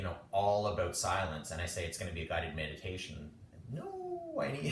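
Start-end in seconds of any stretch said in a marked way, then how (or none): 3.7-4.6 high, soft wail of protest; play-acted panicky "no", drawn out and high; mock freak-out. Meditation teacher imitating a silence lover hearing it's guided, mock freak-out. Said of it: "a moment of, of freak out".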